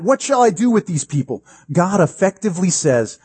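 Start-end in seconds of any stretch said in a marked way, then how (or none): 0.0-1.4 high-pitched imitated plea; mock cry of exasperation; exasperated crying out. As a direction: act out a desperate appeal to God. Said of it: "When Moses cries out".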